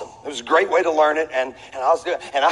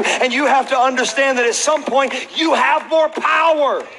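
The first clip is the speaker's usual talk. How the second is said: preaching; 4 semitones higher than usual. Acted. Pastor urges the congregation, full preaching voice. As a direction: drive the lesson home from the pulpit.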